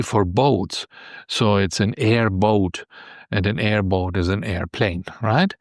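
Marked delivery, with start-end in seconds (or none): none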